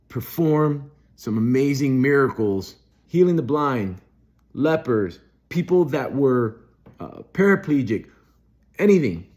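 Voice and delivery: preaching at full force. Acted. Good Friday preacher to his listeners, preaching at full force. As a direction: proclaim it to the congregation, building fervor. Preaching about Jesus's message.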